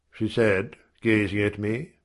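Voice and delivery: ordinary delivery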